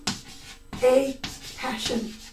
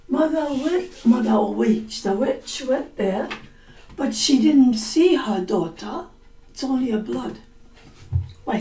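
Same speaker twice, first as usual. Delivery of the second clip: plain reading voice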